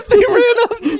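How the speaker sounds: high laughing disbelief; amused disbelief. Real, not acted. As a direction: laugh as you say it.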